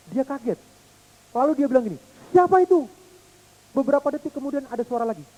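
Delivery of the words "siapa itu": high startled call, louder. Pretend. Little boy calling to the unseen voice, startled shout. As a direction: shout into the empty hills. Scared boy calls into hills.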